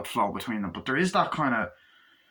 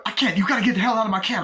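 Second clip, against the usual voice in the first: high, loud and fast panicked shout; 9 semitones higher, 4 dB louder, faster than usual. Retold or imitated. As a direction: rush it, yelling him out of the cab. Cabbie ordering hitman out.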